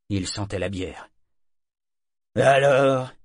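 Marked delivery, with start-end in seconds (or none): 2.4-3.1 raised, taunting question; mocking. A narrator's reading. Beery shipmate looms over the boy, mocking. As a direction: jeer it at him loudly. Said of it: "fit-il d'un ton railleur".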